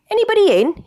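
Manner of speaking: high calling shout. A narrator's reading. Frog calls out on arriving at the door. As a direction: call out into the house. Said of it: "he shouts".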